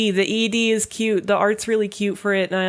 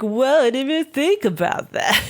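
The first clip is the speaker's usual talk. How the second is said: put-on higher, slow, spacey girl voice; airheaded anime girl; 7 semitones higher than usual. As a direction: play it ditzy and slow. Karin quoted.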